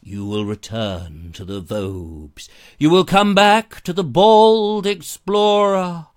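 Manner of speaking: slow, hammed-up hypnotic command; hypnotist voice. Hypnotising viewers to come back, slow hypnotist voice. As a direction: intone it like a stage hypnotist. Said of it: "I did a bit of hypnosis".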